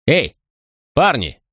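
called out across the room; shouted call across the hall. A narrator's reading. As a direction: raise the voice to carry. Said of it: "крикнул Макрон через зал".

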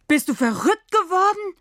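put-on a boy yelling at a friend after near-drowning; angry shout. A narrator's reading. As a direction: scream at her in shock and anger. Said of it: "schreit er Sina an".

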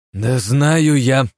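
high, slow frustrated shout; exasperated outburst. A narrator's reading. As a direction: snap it in exasperation. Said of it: "экспрессивный настрой".

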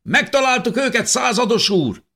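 high, loud soldier's shout; triumphant shout. A narrator's reading. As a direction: call out the find. Soldier reports to captain.

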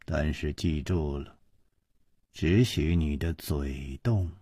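low, quiet, icy questioning; cold, hard interrogation. A narrator's reading. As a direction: interrogate coldly with hushed menace. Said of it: "这个声音接着说". (低声冷硬地逼问)

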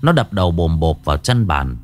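plain reading voice

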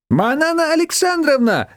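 put-on high-pitched effusive cry in a strong Georgian accent; lament-greet her, heavy Georgian accent; wailing, Georgian accent. A narrator's reading. Shushana greeting an unexpected guest, wailing with Georgian accent. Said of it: "запричитала Шушана с резким грузинским акцентом".

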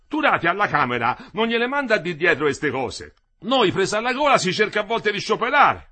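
high, loud, emphatic character speech; heated worker arguing. Acted. Worker railing to his mates about the bosses, heated. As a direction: argue it out loudly, pitch riding high.

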